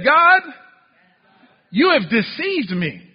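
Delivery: loud, high cry on the first word; frustrated accusation. Acted. Preacher voices the prophet accusing God, frustrated. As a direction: call out, then slow into the accusation. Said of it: "he's frustrated with God".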